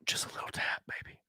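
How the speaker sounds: soft breathy whisper, lips close to the mic; teasing whisper. Pretend. Streamer whispers a teasing aside to chat, hushed. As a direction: whisper it like a playful tease to chat.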